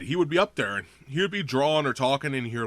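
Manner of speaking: ordinary delivery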